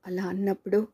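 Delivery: ordinary delivery, nothing marked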